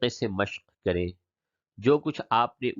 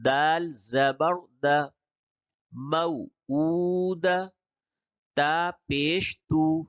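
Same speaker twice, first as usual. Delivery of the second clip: slow chanted syllables with long held vowels; spelling-out recitation; 3 semitones higher, slower than usual. Shown as a demonstration. Teacher drilling Qaida words by hijje, slow chanted recitation. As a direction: spell each letter, then draw out the joined word. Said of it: "یہ ہجے کر کے پڑھا گیا".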